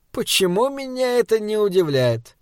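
high start, sliding down knowingly; wry exasperation. A narrator's reading. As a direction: ask it as a sardonic rhetorical question.

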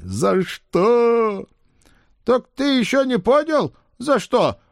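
loud, high mocking spirit. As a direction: tease him from hiding. Spirit voice asks.

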